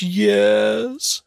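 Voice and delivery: mock weepy "yes"; crying little girl. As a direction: imitate a tearful toddler giving in. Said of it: "she's still crying".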